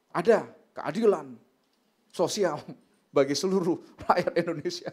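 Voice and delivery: loud mock-fierce declaration; fierce retort. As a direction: hammer it out like a stern retort. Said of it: "Wah, galak banget gitu ya".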